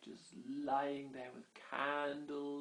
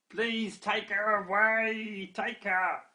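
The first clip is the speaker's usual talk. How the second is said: goblin voice; 7 semitones higher, 6 dB louder, much slower than usual. Acted. Goblins hand back the pixie.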